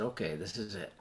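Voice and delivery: resigned hush; quiet. Retold or imitated. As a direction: say it barely audible, resigned. Resigned thought.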